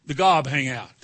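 normal talking voice

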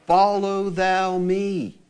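very slow, stretched out; slow drawn refrain. Acted. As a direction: lay each word down firmly.

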